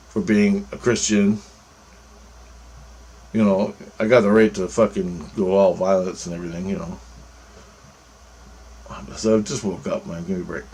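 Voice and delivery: normal talking voice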